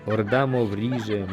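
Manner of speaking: ordinary delivery, nothing marked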